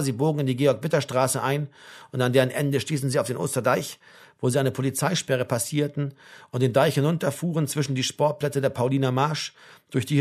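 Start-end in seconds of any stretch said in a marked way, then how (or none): none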